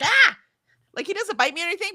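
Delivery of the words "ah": sudden high, loud cat yelp; a cat flinching at a touch, yelped; startled cat. Retold or imitated. Cat voice recoiling when mom goes to pet him, high and loud. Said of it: "He's like".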